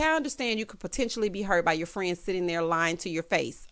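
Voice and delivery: ordinary talk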